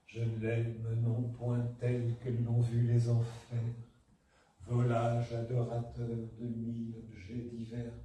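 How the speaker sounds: soft, low, slow recitation; half voice. Shown as a demonstration. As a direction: murmur the verse as if to yourself. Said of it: "elle le disait quasiment à mi-voix".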